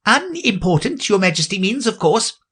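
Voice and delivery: high, polite rabbit correcting the king; very proper and deferential, a touch too eager; high respectful correction of the king; respectable tone. A narrator's reading. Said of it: "in a very respectable tone".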